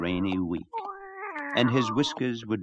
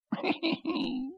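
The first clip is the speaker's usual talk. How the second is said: high rooster cackle; 6 dB quieter than usual. Acted. Rooster laughs.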